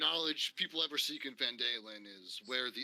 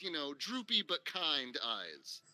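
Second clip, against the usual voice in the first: usual speaking voice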